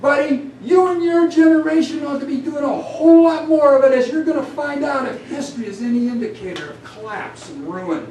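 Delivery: Job's angry warning; really mad. Acted. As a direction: let them have it. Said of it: "Really mad now".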